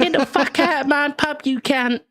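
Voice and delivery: high, hammed-up Cockney landlady shriek; Barbara Windsor impression. Doing Barbara Windsor throwing someone out of her pub, high Cockney screech. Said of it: "Barbara Windsor as well".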